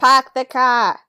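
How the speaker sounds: put-on lightly done Boston accent, r dropped. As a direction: say "Park the car" with a Boston broad a, no r. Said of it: "gnarly Boston accent".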